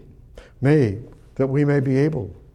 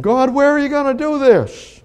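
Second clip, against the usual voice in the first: calling out to God; 12 semitones higher, 6 dB louder than usual. Acted.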